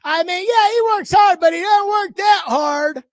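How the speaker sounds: scornful send-up of a begrudging colleague; mocking dismissal. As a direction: mimic the grudging critic, ending in a slow half-shout. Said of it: "you act like".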